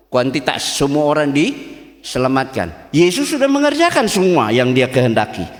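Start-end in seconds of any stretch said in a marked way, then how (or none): 2.9-5.5 high, loud sermon climax; forceful preaching. Real, not acted. Preacher proclaiming that Jesus finished the work, full-force preaching. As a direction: drive each phrase home at full voice.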